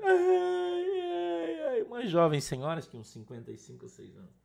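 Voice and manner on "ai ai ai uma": high mock sobbing wail; imitated crying. Woman weeping to the pastor, mock crying wail.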